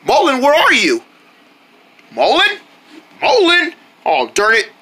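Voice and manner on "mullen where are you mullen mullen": higher, searching calls; acted worried calling. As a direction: call out for someone who has vanished. Narrator calls for missing owl.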